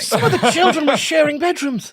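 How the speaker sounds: high, loud scandalised voice; hammed-up horror, laughing through it; mock horrified snob. Teasing friend about poor families, high mock horror. Mocking someone pitying the poor.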